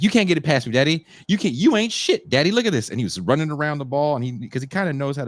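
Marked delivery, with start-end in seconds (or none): 0.0-2.9 put-on high, cocky kid voice; little son taunting. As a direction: play the bragging little boy, pitched way up. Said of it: "He's like".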